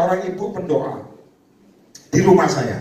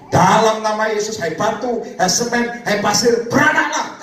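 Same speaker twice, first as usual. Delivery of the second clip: slow, ringing command rising higher; commanding faith declaration; 4 semitones higher, slower than usual. Retold or imitated. Man commands cement and sand to multiply, slow rising command. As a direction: order each thing in turn, climbing to the last word. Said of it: "Dia cuma ngomong gini".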